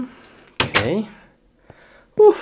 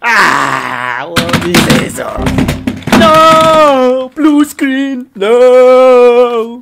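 very loud, high, drawn-out wail; hammed-up rage scream at a crash; screaming in despair; 8 semitones higher, 13 dB louder, much slower than usual. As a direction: scream it as if all is lost. Screaming at blue screen.

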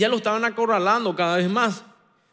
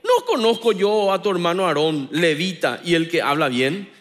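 raised, slowed sermon with punched words; full-force preaching; 6 semitones higher, 4 dB louder than usual. Acted. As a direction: preach it with fire to the congregation.

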